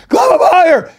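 very high, loud squeezed yell; retold panicked shout. Boy to friend as crocodile shows up, panicked shout. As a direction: mock-desperate scream to move up. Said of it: "And he's like".

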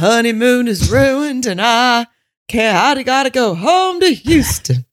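put-on "My honeymoon is ruined" in a pleading Southern accent; upset Southern lady. Mocking a wife flying home to Houston, Southern accent. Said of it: "Southern lady voice".

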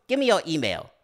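loud, high, punchy demand; hammed-up impression of an actor; James Hong. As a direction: blurt it out in a big imitation voice. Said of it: "much better James Hong impression than me".

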